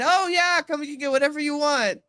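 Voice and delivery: high, loud mock generosity; imitated eager offer. Voices Grillo's generous offer, retelling.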